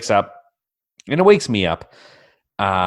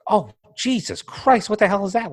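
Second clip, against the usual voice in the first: shocked; 10 semitones higher than usual. Retold or imitated. Seeing huge spider.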